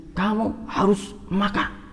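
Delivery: high, slow, insistent "Kamu harus makan"; loving parent insisting. Retold or imitated. Parent insisting a hungry child eat, high and slow. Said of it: "Intonasinya itu juga beda".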